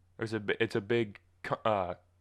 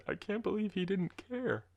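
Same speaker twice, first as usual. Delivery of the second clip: high, soft mock-wounded whimper; high mock heartbreak, softer; mock heartbroken; 10 semitones higher, 4 dB quieter than usual. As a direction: pretend to be crushed, hammed up. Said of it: "sitting in the fetal position just thinking".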